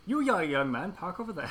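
high, loud grande dame; Judi Dench voice. Acted. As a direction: boss him about as a grand old dame. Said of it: "I did a pretty mean Judi Dench".

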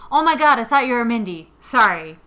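fast, loud mock apology; loud fake mix-up. Guy pretending he thought she was Mindy, fast and loud. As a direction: rush it out, faking the mistake. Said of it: "You should be like".

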